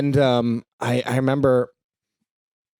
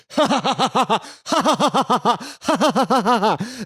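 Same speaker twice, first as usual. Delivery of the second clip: laughing; 7 semitones higher than usual. Real, not acted. Laughing at the memory.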